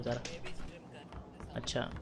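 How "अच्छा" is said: ordinary talk, nothing marked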